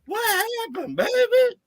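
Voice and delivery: high falsetto, pleading cry; high mock-tearful what happened baby. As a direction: whine it like a heartbroken fan. Repeats mock pleading to Purdy.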